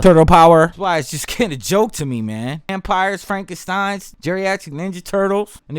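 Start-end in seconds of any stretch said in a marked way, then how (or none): 0.0-0.7 loud punchy cartoon catchphrase; theme-song shout. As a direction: blast it out like the show's jingle.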